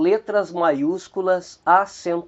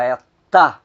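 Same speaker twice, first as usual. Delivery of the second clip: "tá" isolated and punched louder; stressed syllable shown; 4 semitones lower, 5 dB louder, faster than usual. Shown as a demonstration. Teacher isolating the stressed syllable for the class, punched out. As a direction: pull out "tá" on its own and hit it. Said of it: "a sílaba que soa mais forte é a última".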